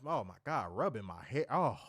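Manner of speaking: ordinary talk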